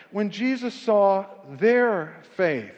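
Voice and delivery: slow, each word weighed; slow verse reading. Acted. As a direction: read it slowly, letting it land.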